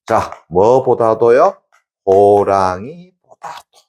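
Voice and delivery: slow, stretched recitation; slow, deliberate teaching voice; slow emphatic drill. Shown as a demonstration. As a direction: spell it out slowly for students. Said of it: "teacher drills the saying".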